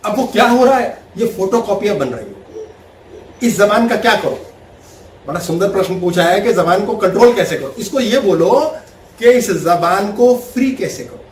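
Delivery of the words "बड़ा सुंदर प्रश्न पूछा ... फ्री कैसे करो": usual speaking voice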